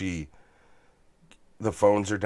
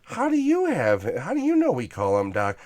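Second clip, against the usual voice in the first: mimicking Wendy; 8 semitones higher, 4 dB louder than usual. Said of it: "she's like".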